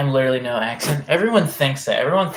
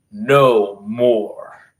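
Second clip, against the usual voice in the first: loud, drawn-out "no more"; no more meme; 3 semitones lower, 6 dB louder than usual. Retold or imitated. Streamer quoting the no more meme, drawn-out mock proclamation. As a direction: boom out the meme line slowly. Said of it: "the no more meme".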